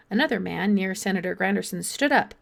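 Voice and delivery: ordinary delivery, nothing marked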